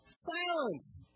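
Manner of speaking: high, sharp command; a short stern shout of authority; commanding rebuke. Retold or imitated. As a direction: order the storm to stop. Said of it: "It literally is something like".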